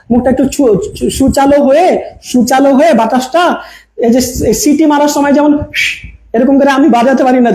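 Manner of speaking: ordinary talk, nothing marked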